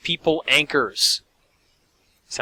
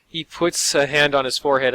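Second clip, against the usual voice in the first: normal talking voice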